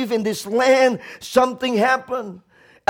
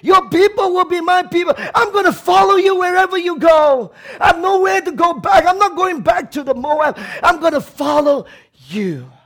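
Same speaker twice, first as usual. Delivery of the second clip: a raised, pitched-up, fervent declaration; Ruth's vow; 8 semitones higher, 4 dB louder than usual. Acted. Preacher voicing Ruth refusing to leave Naomi, fervent and raised. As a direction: Ruth pledging herself, loud and sure. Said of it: "Ruth said".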